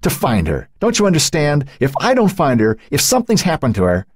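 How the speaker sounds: desperate, climbing-pitch plea; distraught, urgent explanation; anguished. A narrator's reading. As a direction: make them understand, pitch climbing with dread. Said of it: "his eyes anguished".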